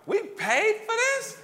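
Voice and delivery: high, loud, slow disbelief at the price; incredulous. Retold or imitated. Dad flipping a bad kids' book to check its price, loud high disbelief. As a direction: drag it out high and loud, as if you can't believe you paid for it. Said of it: "every time I'd do like this, flip it over".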